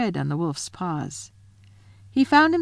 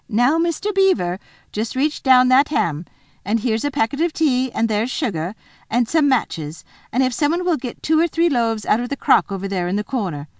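higher, busy housewife voice; bustling Missus Beaver; 6 semitones higher, 4 dB louder than usual. Acted. Missus Beaver orders her husband to pack, high bustling voice. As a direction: list the chores in a high, brisk bustle.

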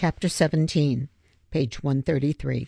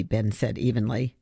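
usual speaking voice

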